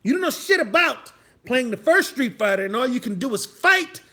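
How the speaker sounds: loud, high emphatic tirade; worked-up scolding; heated comic rant. Pretend. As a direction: hammer each name at clueless youngsters. Comic rant about old games.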